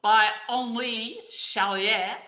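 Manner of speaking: slow, put-on French accent for the names. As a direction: say Papillon and Henri Charrière the French way. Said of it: "use my French accent".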